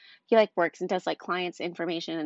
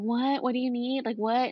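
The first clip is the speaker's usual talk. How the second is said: higher-pitched imitation of whining; whiny mimicry; 5 semitones higher than usual. Wife mimicking husband's reply when interrupted at work, whining. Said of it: "kinda whining and then yelling at me".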